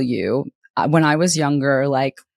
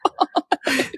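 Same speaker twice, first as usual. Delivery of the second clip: mock gasp; 8 semitones higher than usual. Retelling sobbing over dead whale.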